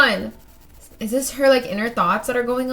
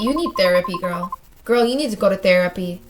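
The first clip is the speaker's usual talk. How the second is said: usual speaking voice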